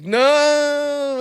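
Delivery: a high, loud, hammed-up cry of no; mock movie heartbreak, way up high; Titanic farewell. Reaching for his roommate like a Titanic scene, a high mock-tragic no. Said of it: "like, Titanic hands".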